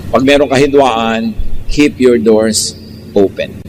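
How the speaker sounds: ordinary talk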